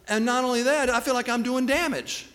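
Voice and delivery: acted-out complaint to God, pitched high; retold distressed plea. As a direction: plead upward with a touch of despair.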